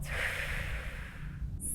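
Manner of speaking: soft breathy hiss out; gentle playful cat hiss. Pretend. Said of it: "hiss like a cat".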